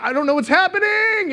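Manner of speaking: high, loud panicked cry; mock panic. Husband tipping off the jet ski, high mock-panicked yell. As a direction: play up bewildered alarm at full pitch. Acting his panic as he tips over.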